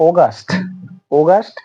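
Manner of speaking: অগাস্ট said "awgast"; British pronunciation. Shown as a demonstration. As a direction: model অগাস্ট British style. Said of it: "পরবর্তীতে আছে". (British উচ্চারণে অগাস্ট)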